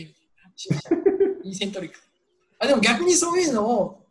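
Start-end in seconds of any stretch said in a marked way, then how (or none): 1.4-2.0 イーセントリック, the English way, quiet and correcting; English eccentric. Retold or imitated. Quoting the foreign professor, long-イー イーセントリック. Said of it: "エキセントリックなんて言わない".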